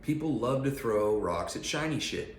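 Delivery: usual speaking voice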